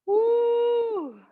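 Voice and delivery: long, high "woo"; sustained whoop of exertion; effortful whoop. Real, not acted. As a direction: let out a drawn-out high whoop. Exertion holding a pose.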